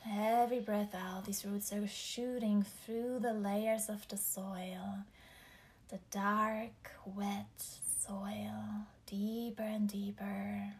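slow, whispered meditation voice; guided meditation. Acted. Leads grounding meditation.

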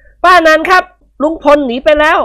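put-on high kid's voice; tattling kid. Boy telling his aunt that uncle ran off, high kid voice. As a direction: tell on uncle eagerly. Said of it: "ร้องขึ้นดังๆ".

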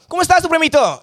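high-pitched teasing hello; hammed-up high greeting to a buddy; pestering greeting. Acting out his pestering greeting on video.

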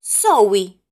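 called out loud. A narrator's reading. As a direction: say the name out loud, proudly. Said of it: "volvió a decir en voz alta".

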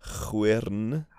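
'Chwyrn' with a throaty ch and a rolled r; slow Welsh 'Chwyrn', ch as in loch; dictionary pronunciation. Shown as a demonstration. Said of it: "syllable by syllable".

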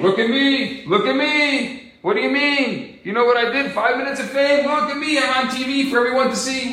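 high, insistent show-off voice; mock attention-seeking boast. Speaker mimicking fame seekers demanding attention, mock boasting.

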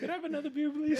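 high, small begging voice; mock pleading voice. To his friend, a mock pleading request for another beer.

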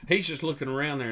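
usual speaking voice

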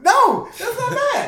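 squeaky laughing protest; laughing high. Real, not acted.

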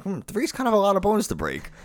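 high-pitched, mock-pondering reply; hesitant listener weighing options. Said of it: "You'd be like".

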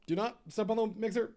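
laughing half-scold at the cat. Real, not acted. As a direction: stop the cat before she steps.

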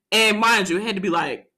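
ordinary talk

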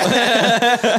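high burst of laughter. Real, not acted. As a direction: let out a big high laugh. High laugh after joke.

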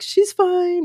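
high-pitched tearful wail, acted out; imitated sobbing voice. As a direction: squeal it as if crying too hard to talk. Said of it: "which is why I'm sobbing".

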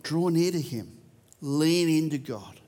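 ordinary talk, nothing marked

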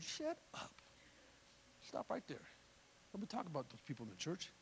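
very soft, low reprimand; hushed rebuke. Acted. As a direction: cut the gossip off under your breath. Pastor cuts the gossip short.

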